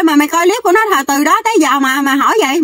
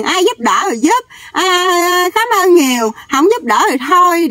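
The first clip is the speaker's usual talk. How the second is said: mocking imitation; 5 semitones higher than usual. Mocking the couple's careless attitude.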